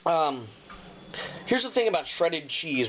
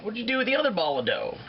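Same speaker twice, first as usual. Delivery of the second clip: mock viewer question; 8 semitones higher than usual. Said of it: "Now at this point you might say".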